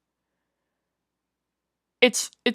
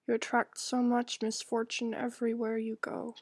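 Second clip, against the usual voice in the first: lightly voiced stern mother, cold and reproachful; 9 dB quieter than usual. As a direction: scold the girl gently but coldly, barely above speaking voice.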